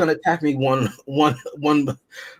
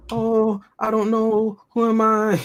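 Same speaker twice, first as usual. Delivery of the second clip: high, whiny imitation of crying; mock crying; 8 semitones higher, much slower than usual. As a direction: imitate someone crying, mockingly. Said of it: "When Scott is crying".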